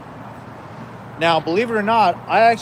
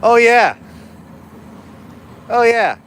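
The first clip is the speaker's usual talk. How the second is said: loud exclaim; 5 semitones higher, 4 dB louder, slower than usual. Pretend. Loud exclaim, measures high.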